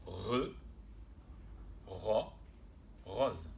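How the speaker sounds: « re, ro, rose » construit son par son; détacher « re », « ro », puis « rose », avec des pauses; découpage syllabique. Shown as a demonstration. Mot découpé pour les élèves. (« re… ro… rose » syllabé lentement pour des élèves)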